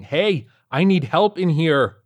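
loud, high, slow shout for help. Acted. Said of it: "waving and shouting".

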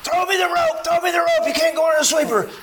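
very high, urgent distant yell; screaming plea. Retold or imitated. Buddy in the river begging for the rope, screamed plea. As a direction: yell for the rope from across the water. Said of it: "and he's screaming at me".

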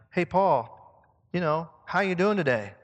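loud, hearty mock greeting; casual greeting. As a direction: call out to a friend in passing. Acts out greeting Paul.

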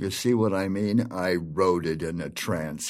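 muttered under his breath; quiet aside. Acted. Producer's private aside.

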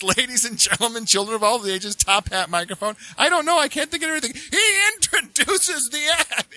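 hammed-up exasperated host, laughing through it; going nuts. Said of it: "the captain starts going nuts".

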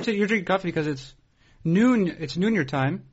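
ordinary talk, nothing marked

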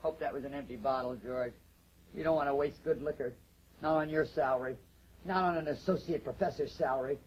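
low, soft, needling; subdued, cutting scorn; cool contempt. Acted. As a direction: belittle him quietly after the blow-up. Wife coolly needles husband after smash.

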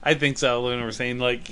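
ordinary talk, nothing marked

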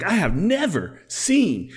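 high, drawn-out Southern senator parody; Lindsey Graham Southern. Retold or imitated. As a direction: ham up a Southern senator's indignation. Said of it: "he got all Southern".

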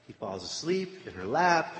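ordinary talk

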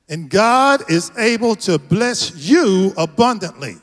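slow, weighty preaching delivery; emphatic scripture recital. Real, not acted. Pastor declaring the theme verse to the congregation, slow and emphatic. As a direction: declare the verse, leaning on key words.

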